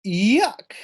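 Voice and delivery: high, loud grossed-out yuck; disgusted yuck. Acted. Maddie recoiling from the smelly backpack, high loud yuck. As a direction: squeal it like a kid grossed out by rotten eggs. Kid voiced in read-aloud.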